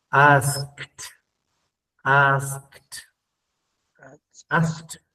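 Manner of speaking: "asked" ending in a T sound. Shown as a demonstration. Teacher models the T ending of "asked". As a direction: clip "asked" off with a T. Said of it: "va a sonar como una T".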